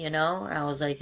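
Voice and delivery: usual speaking voice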